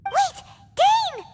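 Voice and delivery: high, urgent call to stop him. Acted. Paimon calls Dainsleif back, urgent high shout. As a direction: shout after him to wait. Calling out to companion.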